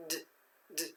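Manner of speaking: "D" as short, separate voiced sounds. Shown as a demonstration. Teacher tests whether d is voiced, short voiced "d". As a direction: voice "d" briefly, not the letter name. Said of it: "It is also voiced".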